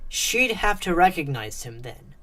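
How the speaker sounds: ordinary delivery, nothing marked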